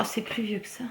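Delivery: chuchoté pour soi, en se corrigeant; souffle-le comme une remarque pour toi-même; aparté chuchoté. Real, not acted. Aside correcting herself. (petit chuchotement en aparté)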